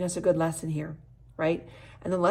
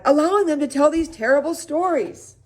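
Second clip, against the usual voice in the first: indignant; 10 semitones higher, 7 dB louder than usual. Acted. Dad outraged at counselor.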